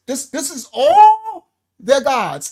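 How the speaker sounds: slow and loud, on the edge of tears; choked up. Real, not acted. Teacher overwhelmed by the list of gods, near tears. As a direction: overcome with feeling as he reads. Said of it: "sorry, I'm emotional like this".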